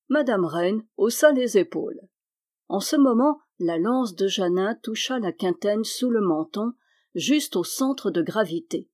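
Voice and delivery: ordinary delivery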